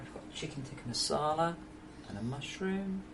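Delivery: softly listing the dishes; quiet presentation. Real, not acted. Streamer showing viewers the dinner, quiet presentation. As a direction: present each dish softly, tune lifting at the ends.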